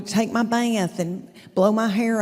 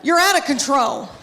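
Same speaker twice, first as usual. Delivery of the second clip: mock yell at son; 3 semitones higher than usual. Mother pretends to yell at her teenage son, mock stern yell. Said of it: "if I had to yell at my boy".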